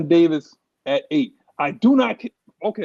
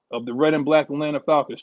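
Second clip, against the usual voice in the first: usual speaking voice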